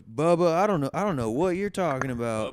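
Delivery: high, loud mock denial; evasive caller. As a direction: play dumb as the caller. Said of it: "he goes".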